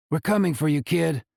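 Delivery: ordinary delivery, nothing marked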